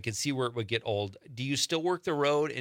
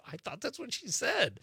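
high defensive guy; 11 semitones higher, 4 dB quieter than usual. Retold or imitated. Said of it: "he's like".

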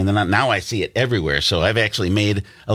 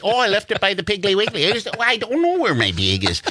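high Wisconsin "bag" said like "beg"; Wisconsin accent; 10 semitones higher than usual. Retold or imitated. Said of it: "We say bag".

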